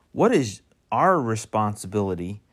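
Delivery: "our" set apart and punched; stress on our. Real, not acted. Preacher turning from God's responsibility to ours, heavy stress on "our". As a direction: lean hard on "our" as the contrast.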